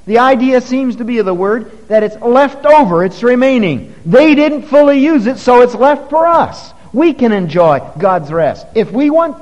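preacher denouncing grumbling Israel; preaching at full force. Acted. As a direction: hammer the point home.

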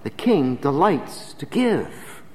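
"The king delights to give" very slow and soft; slow and quiet. Shown as a demonstration. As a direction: let "The king delights to give" settle slowly. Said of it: "quiet slow moral".

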